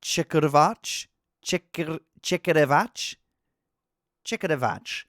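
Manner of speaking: sounding out "Chekirevach" haltingly; unsure stab at a foreign surname; tentative pronunciation attempt. Real, not acted. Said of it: "I'm gonna have a go at this".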